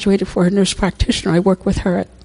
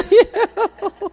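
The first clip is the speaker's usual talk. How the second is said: laughing; 12 semitones higher than usual. Real, not acted. Laughing after hospital story.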